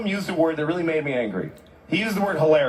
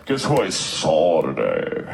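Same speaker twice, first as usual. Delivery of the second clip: imitating a dull guy; slower than usual. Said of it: "One guy goes".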